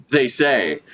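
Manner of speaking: ordinary talk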